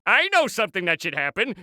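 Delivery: usual speaking voice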